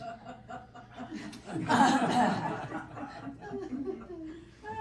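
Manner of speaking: a real, high-pitched laugh that rises and rolls on; high burst of laughter. Real, not acted. Laughing to the listeners at the client pinching off tomato flowers.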